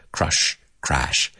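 crush with "uh", then crash with open "ae"; vowel contrast demo. Shown as a demonstration. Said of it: "pronunciar la diferencia entre crash and crush".

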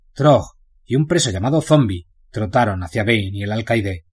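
usual speaking voice

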